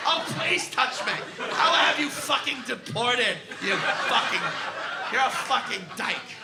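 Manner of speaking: put-on loud drunk woman cursing the bouncer; drunken screaming. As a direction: yell it out, sloppy and furious.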